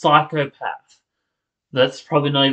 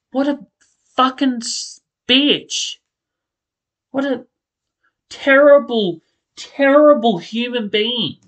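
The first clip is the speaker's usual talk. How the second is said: high, furious rant at a TV villain; real angry outburst; 9 semitones higher than usual. Real, not acted. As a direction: spit it out, genuinely fed up. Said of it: "I'm actually angry".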